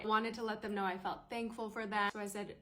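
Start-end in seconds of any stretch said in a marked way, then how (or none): none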